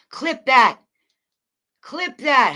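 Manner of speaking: a loud call to chat to clip it; loud demand. Real, not acted. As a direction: bark it at chat so they clip it.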